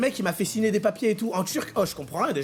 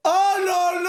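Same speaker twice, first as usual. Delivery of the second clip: cri d'effroi rejoué; 8 semitones higher, 5 dB louder than usual. Retold or imitated. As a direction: cry out long and high in mock dismay. Reacts to more injections being announced. (grand cri aigu et traînant)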